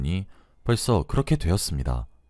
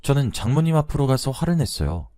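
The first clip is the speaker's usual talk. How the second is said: usual speaking voice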